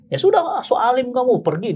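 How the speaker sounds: high, irritated retold dismissal; angry father. Father throwing out the suitor, high and angry. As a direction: imitate a father sending someone away. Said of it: "bapaknya ngamuk".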